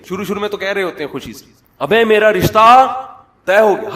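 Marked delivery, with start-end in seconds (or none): 1.8-3.9 loud, higher, drawn-out happy news; a young man crowing to his friends that his marriage is fixed; excited announcement. Acted. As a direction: shout it out to friends, slowly and proudly.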